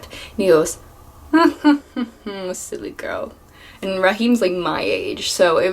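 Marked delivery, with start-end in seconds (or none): 1.3-3.3 put-on Jamaican accent chuckle. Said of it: "try my best to do this accent".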